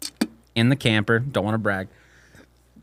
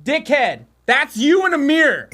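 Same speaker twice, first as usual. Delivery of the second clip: mock scolding the dog; 12 semitones higher than usual. Owner telling his dog it's his reflection. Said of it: "I'm like".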